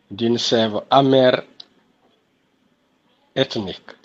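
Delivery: measured declamation with long pauses between verses; poem recitation. Acted. (récitation de poème déclamée)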